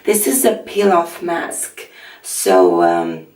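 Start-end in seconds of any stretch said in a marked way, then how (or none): 2.2-3.3 low, husky just-woke-up voice; croaky first words of the day; morning voice. Real, not acted. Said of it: "my voice is my morning voice".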